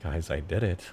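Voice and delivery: usual speaking voice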